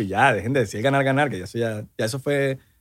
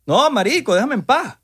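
fed-up; 8 semitones higher than usual. Real, not acted. Fed-up rant at recruiters.

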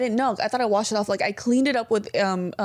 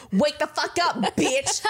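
higher-pitched mock yelling alarm; Bretman Rock impression; 4 semitones higher, slower than usual. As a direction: imitate a sassy wake-up yell without full volume. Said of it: "is Bretman Rock yelling".

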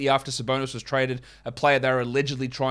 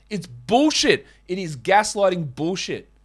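louder, higher angry outburst; fired up; 5 semitones higher, 4 dB louder, slower than usual. Real, not acted. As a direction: vent real frustration. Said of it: "I'm fired up".

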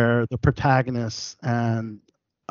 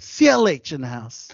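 ordinary delivery

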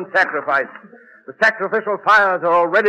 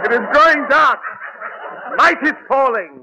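overacted doom; 5 semitones higher than usual. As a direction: ham up the dark warning. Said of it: "[name] overacting".

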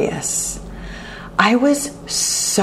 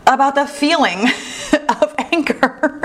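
laughing; 7 semitones higher than usual. Real, not acted. Laughs at her own thought.

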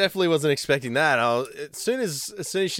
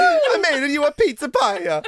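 put-on higher, laughing joke Italian accent; mock Italian accent; 9 semitones higher, 4 dB louder than usual. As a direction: offer the pizza in a hammed-up Italian voice. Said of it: "Why is she Italian?".

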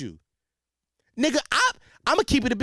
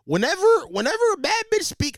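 much higher, put-on diva voice; bad bitch voice; 10 semitones higher than usual. Man wishing he sounded like a bad bitch, high voice. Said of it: "I wanna sound like a bad bitch".

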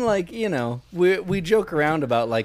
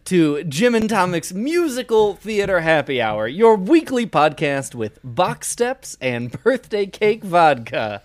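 show host intro; 4 semitones higher, 4 dB louder, slower than usual. Acted.

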